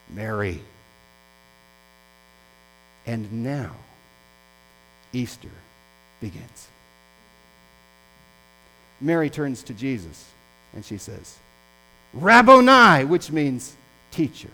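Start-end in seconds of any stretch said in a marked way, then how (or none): 0.1-0.6 low, soft, gentle naming; tender calling of her name. Acted. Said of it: "I loved the tone of voice". 12.1-13.0 a sudden high, loud cry of recognition; joyful recognition. Acted. Mary recognizes the risen Jesus.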